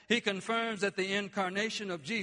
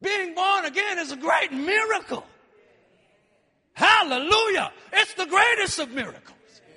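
preaching at full force; 7 semitones higher, 8 dB louder than usual. Acted. Shouted preaching climax.

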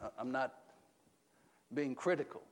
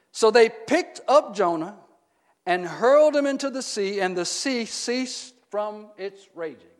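loud, raised pitch, slowing at the end; dramatic scripture reading; 3 semitones higher, 12 dB louder than usual. Acted. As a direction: proclaim the verse with building drama. Reads scripture aloud to congregation.

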